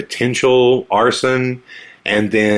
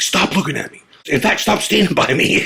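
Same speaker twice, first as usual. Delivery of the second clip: high, panicky retelling of a paranoid friend; paranoid friend freaking out; 8 semitones higher than usual. Retold or imitated. The friend panics outside the school, high and jittery. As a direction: squeak it out like a scared buddy. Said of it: "so paranoid, he was freaking out".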